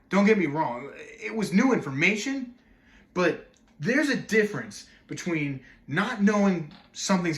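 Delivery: loud, high, exasperated argument; worked-up outrage with big pitch swings; indignant rant. Acted. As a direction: rail at the interviewer, punching key words. Acted indignant rant at Missus Oates.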